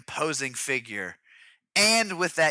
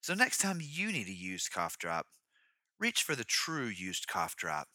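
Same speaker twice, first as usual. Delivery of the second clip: mock commercial read; 8 semitones lower than usual. Mock cough drop ad tagline.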